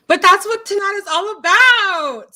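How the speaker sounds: loud, bright-eyed party voice; real tipsy exuberance, way up and loud. Real, not acted. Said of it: "Sorry, I'm so loud".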